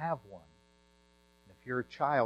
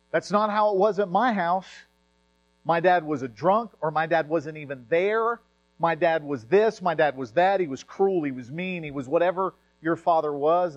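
hurt protest; 6 semitones higher, 8 dB louder than usual. Retold or imitated. Voicing a hurt listener.

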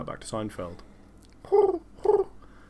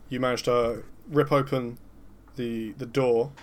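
normal talking voice

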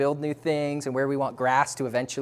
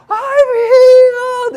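drawn-out, much higher and louder cry of joy; joyful shout; 14 dB louder, much slower than usual. Retold or imitated. Stuart to the prayer group after his push-ups, jubilant shout. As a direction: stretch it out like you can't contain it.

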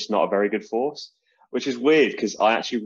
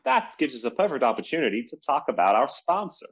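a slightly higher, half-committed American sponsor-read voice; half-hearted American accent; 5 semitones higher than usual. Pretend. Podcast host starts the sponsor read in a half-committed American accent. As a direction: slip into a light American ad-read accent, then lose it. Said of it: "I didn't really commit to the American accent".

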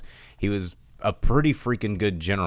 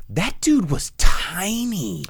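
amazed; 8 semitones higher, 5 dB louder, much slower than usual. Real, not acted. Stunned by tiny Napoleon clothes.

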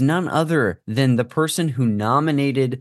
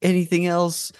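higher, drawn-out eager client voice; mock eager client; 9 semitones higher, slower than usual. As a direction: gush like a picky client. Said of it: "They're like".

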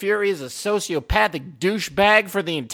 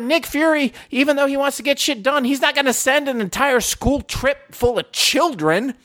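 heated explaining; 9 semitones higher than usual. Retold or imitated. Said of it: "I was like".

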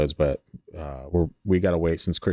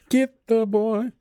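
laughing creepy quote; much slower than usual. Pretend. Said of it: "that is a movie".

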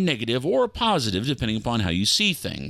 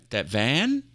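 ordinary talk, nothing marked

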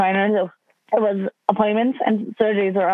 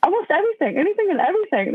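high-pitched admission; 10 semitones higher than usual. Retold or imitated. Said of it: "I'm like".

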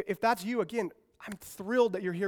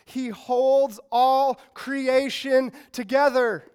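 slow, weighty and louder, every word landed; emphatic, slowed line; 3 semitones higher, 5 dB louder, much slower than usual. Shown as a demonstration. Preacher hammering home the verse to students, slow and loud. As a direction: lean on it so the crowd catches it. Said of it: "and catch this".

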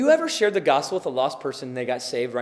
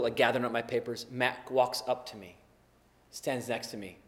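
ordinary talk

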